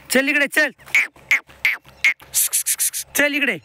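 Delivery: high-pitched call to an animal; calling the calf. Real, not acted. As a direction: sing out to the calf so it comes over.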